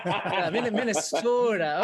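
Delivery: Minnesota drawn out with a long Midwestern O, laughing; slow, laughing Minnesota accent. Retold or imitated. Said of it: "was that your Minnesota accent".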